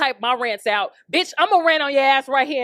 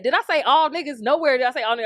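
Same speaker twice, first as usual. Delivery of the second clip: ordinary talk, nothing marked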